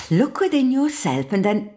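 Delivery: a hammed-up guru sigh then advice. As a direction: play the mystic dispensing wisdom. Guru lady intones advice.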